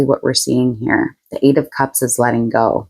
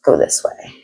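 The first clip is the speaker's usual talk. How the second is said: whispered aside; 8 semitones lower than usual. Real, not acted. Muttering to the cards.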